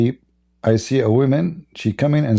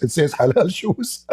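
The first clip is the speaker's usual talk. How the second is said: laughing; 9 semitones higher than usual. Real, not acted. Mocking sign, laughing.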